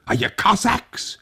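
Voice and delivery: put-on high, thin old man calling out; an old man calling nervously through the window; old Tag, anxious. A narrator's reading. Old Tag calls to riders outside, high and anxious.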